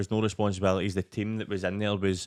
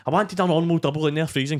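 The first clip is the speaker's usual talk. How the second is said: high, louder indignant moan; mock angry complaint; 8 semitones higher, 6 dB louder than usual. Complaining to Nathan about a cold burger, high and loud. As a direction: complain like a furious customer. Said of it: "I was like".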